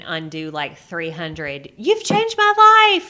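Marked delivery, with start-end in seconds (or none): 1.8-3.1 high, loud, slow gushing praise; gushing fan. Retold or imitated. Quoting a grateful follower, high loud gush. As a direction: play an overjoyed fan thanking you.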